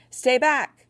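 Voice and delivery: high, loud warning yell. A narrator's reading. Narrator yelling to the soldiers to stay back, high and loud. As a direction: bark the warning at them. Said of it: "I yelled to them in German".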